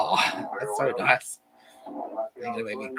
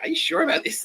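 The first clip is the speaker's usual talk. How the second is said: much higher, incredulous question; doubtful question; 4 dB louder than usual. Retold or imitated. Streamer quotes himself doubting the plan, high incredulous question. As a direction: squeak it out in disbelief. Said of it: "it was like".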